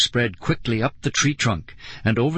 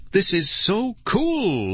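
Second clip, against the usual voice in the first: high, drawn-out squeal; thrilled girl, savouring each word; 8 semitones higher, slower than usual. A narrator's reading. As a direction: squeal slowly with delight. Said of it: "Lauren squealed".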